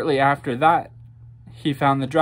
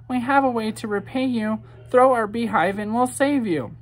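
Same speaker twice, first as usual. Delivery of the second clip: tiny bee voice; 7 semitones higher than usual. A narrator's reading. A bee speaks to the knight.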